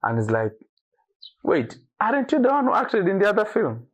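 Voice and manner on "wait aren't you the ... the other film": much higher, excited voice of a fan who recognizes him; surprised fan. Retold or imitated. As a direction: squeal it like a thrilled fan spotting the actor.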